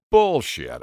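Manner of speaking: loud dismissive scoff. A narrator's reading. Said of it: "I scoffed".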